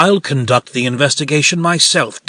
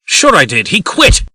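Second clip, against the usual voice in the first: sharp, louder, pitch jumping high on "He quit"; indignant outburst; 5 semitones higher, 6 dB louder than usual. Acted. Posey snapping back at Snow over Smith, loud and indignant. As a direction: snap back defensively.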